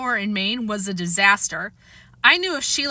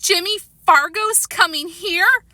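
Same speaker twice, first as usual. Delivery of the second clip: shocked question; 8 semitones higher, 3 dB louder, slower than usual. Acted. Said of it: "Sheila's voice sounded funny".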